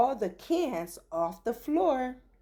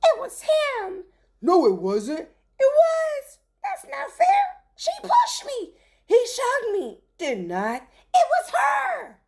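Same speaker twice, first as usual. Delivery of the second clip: put-on high, loud childish bickering; squabbling shoppers; 14 semitones higher, 6 dB louder than usual. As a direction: bicker like kids blaming each other.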